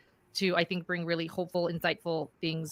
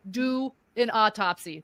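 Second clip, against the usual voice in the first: ordinary delivery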